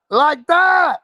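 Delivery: incredulous shout. Real, not acted. Streamer challenges the chat, shouting in disbelief. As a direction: shout it back as if it's unthinkable.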